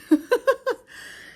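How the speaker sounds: high breathy giggle; breathless laugh. Real, not acted. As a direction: laugh while catching breath. Breathless laugh after stairs.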